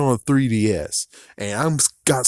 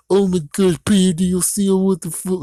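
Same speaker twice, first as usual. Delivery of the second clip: higher, louder mocking fan; mock outraged fans; 8 semitones higher, 3 dB louder than usual. Vlogger parodying fans outraged over paid DLC. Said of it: "you guys are now like".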